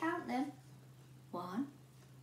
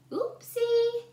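high, louder cheeky oopsie; giggly oopsie; 11 semitones higher, 8 dB louder than usual. A narrator's reading. Goldilocks cheekily shrugging off the broken chair. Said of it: "giggled Goldilocks".

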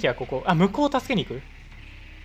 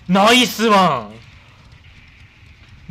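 loud cheer; 5 dB louder, slower than usual. Real, not acted. Game shout after success.

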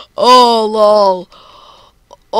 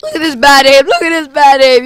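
mocking jeer; 4 semitones higher, 8 dB louder, faster than usual. Real, not acted. Mocking opponent's aim.

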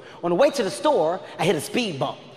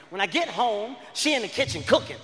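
usual speaking voice

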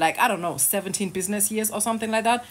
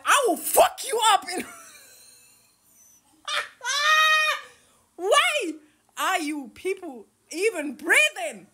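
mocking voice; 12 semitones higher than usual. Mocking imagined tough commenter.

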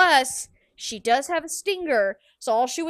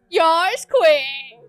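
a hint of a drawn-out moan; lightly voiced moaning quote; mock moan; 4 semitones higher, 7 dB louder than usual. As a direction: tease the sound as a moan. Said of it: "It sounds like you're moaning".

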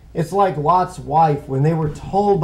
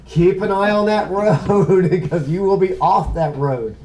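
chuckling through the warning, amused at his own slip; real laughter breaking into the words; laughing at himself; 4 semitones higher than usual. Real, not acted. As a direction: laugh it off, then keep talking with a grin. Laughs at own driving story.

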